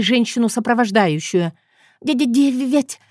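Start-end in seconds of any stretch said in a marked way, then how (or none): 2.0-3.0 stammering from the shaking; being shaken. Acted. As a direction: stutter it out while shaken. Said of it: "едва выговорила она".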